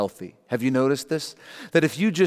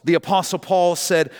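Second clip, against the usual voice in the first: ordinary talk, nothing marked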